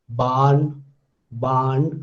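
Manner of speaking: "barn" as a low, long "baan" without r; dropped r. Shown as a demonstration. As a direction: leave the r out of "barn", hold the vowel. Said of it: "I am dropping the r".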